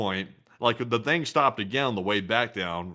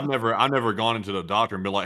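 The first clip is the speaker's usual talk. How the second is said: usual speaking voice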